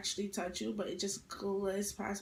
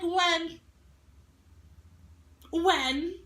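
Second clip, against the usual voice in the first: loud, higher demand to name the time; loud challenge; 6 semitones higher, 6 dB louder than usual. Retold or imitated. Retelling a classmate argument, daring her to set the time loudly. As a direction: call her bluff, loud and sharp.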